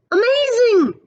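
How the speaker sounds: high, loud cry of delight; amazed shout of delight; excited exclaim. Acted. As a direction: marvel out loud at the haircut. Character exclaims at reflection.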